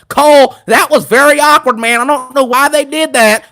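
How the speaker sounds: Corey Graves impression. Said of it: "Corey Graves is on commentary telling Cole".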